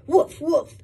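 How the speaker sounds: playful woofs; dog barking. Acted. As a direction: bark like a friendly dog. Dog barks in story.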